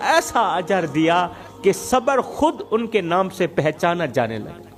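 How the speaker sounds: usual speaking voice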